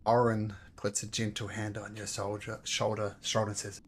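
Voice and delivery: ordinary talk, nothing marked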